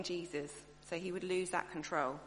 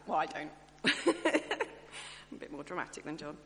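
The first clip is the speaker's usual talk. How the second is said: ordinary delivery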